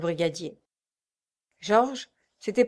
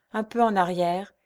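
ordinary delivery, nothing marked

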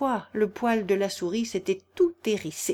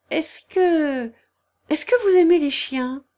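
higher, louder hurried stammer; flustered stammer; 8 semitones higher, 6 dB louder than usual. A narrator's reading. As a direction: trip over the words to change topic. Alice hurriedly changes subject. (bégaiement précipité, plus aigu et plus fort)

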